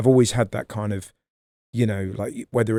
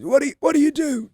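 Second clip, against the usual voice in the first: much higher, fast mock Johnny Vegas; imitating Johnny Vegas; faster than usual. Retelling Vegas quizzing a woman in the crowd, high mock voice. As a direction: pitch up high and rattle it out. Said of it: "And he goes".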